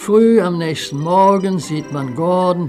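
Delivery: plain reading voice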